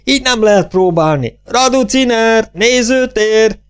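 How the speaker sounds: high-pitched, stretched-out yelling; hysterical shriek. A narrator's reading. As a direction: scream for the stagehands in hysterics. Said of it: "Hisztérikus felsüvöltés". (hisztérikus, magas felsüvöltés)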